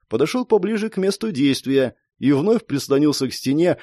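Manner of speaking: usual speaking voice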